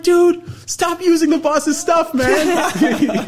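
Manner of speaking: high, loud, slow dude voice; mocking shout. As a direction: scold him like he's stoned. Roommates mock him as high.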